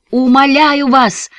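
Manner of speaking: slow, high, loud pleading cry; desperate cry. A narrator's reading. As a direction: cry out, begging with everything. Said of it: "крикнул отец".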